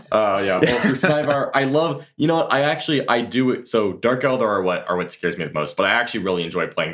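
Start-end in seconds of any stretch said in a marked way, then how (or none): 0.6-3.7 excited. Real, not acted. Laughing reply to joke question.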